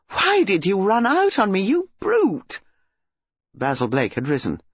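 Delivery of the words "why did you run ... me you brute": high, indignant outburst; angry. A narrator's reading. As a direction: accuse him furiously, pitch leaping on the insult. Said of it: "exclaimed angrily".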